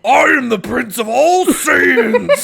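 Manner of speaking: booming, high, drawn-out anime boast; slow, hammy battle cry; present-day Vegeta. Acted. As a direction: shout it like a proud warrior prince. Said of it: "now he's like".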